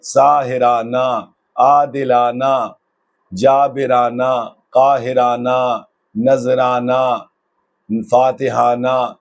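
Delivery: each word said slowly with a pause after, final ہ silent; slow list of words. Shown as a demonstration. Teacher slowly reading out example words for students. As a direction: read Rukhsana, Irfana, ساحرانہ slowly, one by one, ending on a plain a. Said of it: "سب کے اخیر میں ہا لٹکی".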